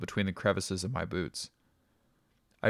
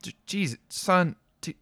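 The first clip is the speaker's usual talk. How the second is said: stammering dad; 9 semitones higher, 3 dB louder, slower than usual. A narrator's reading. Stammering father on phone.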